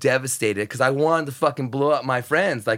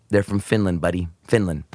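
friend's correction; 8 semitones lower than usual. Retold or imitated. Friend whispers correction in ear.

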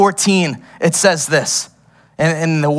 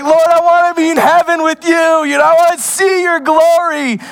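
mock yearning prayer; 10 semitones higher than usual. Preacher acting out a longing prayer, high pleading voice. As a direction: plead to see heaven, pitched up high. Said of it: "It's like".

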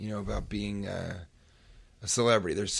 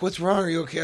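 a higher-voiced mock of the worried wife; 6 semitones higher, 4 dB louder, faster than usual. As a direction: ask with a bit of alarm, voicing his wife. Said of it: "She's like".

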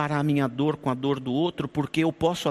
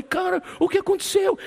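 much higher, worried plea; alarmed question; 13 semitones higher than usual. Retold or imitated. Pastor asking the weeping brother in alarm, much higher. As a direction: ask anxiously, up in the voice.

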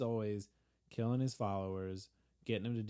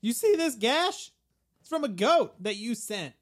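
outraged Peter; 12 semitones higher, 9 dB louder than usual. Retold or imitated. Peter confronting God over the goat, outraged. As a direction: shove the grievance in his face.

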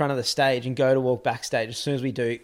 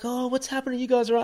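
put-on concerned older people; 11 semitones higher, faster than usual. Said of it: "and they're like".

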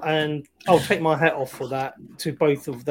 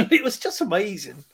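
laughing; 4 semitones higher than usual. Real, not acted. Laughs while repeating line.

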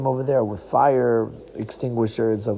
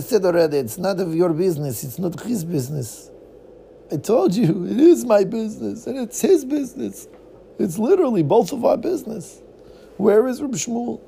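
exasperated; 7 semitones higher, 3 dB louder than usual. Acted. Exasperated retort.

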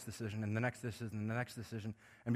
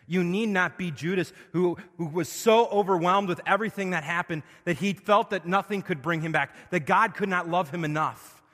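loud, high, building revival sermon; full-force preaching; 5 semitones higher, 11 dB louder than usual. Acted. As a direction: preach it out with rising fire.

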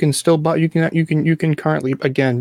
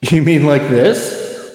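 slow, echoing reverb voice; spring reverb voice; much slower than usual. Pretend. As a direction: talk through a spring reverb. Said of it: "when you do your spring reverb voice".

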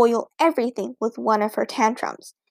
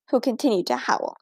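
plain reading voice

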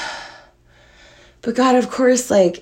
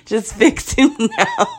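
higher, slow demand breaking into laughter; laughing plea; 6 semitones higher, slower than usual. Retold or imitated. Owner telling the vet to treat her dog, laughing plea. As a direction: retell the demand while cracking up.

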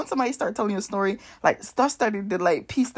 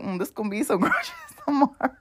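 laughing quip; 4 semitones higher than usual. Retold or imitated. Retold quip about keeping money.

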